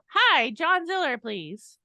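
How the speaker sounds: mock a scripted cold-call greeting; telemarketer reading script. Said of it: "where you've had a telemarketer call".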